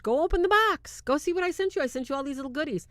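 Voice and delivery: mock mother, high and eager; high mom voice. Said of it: "Her mother says".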